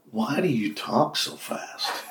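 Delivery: slow joke question. Acted. Telling an old joke, slow drawn-out question. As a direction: stretch it out slowly.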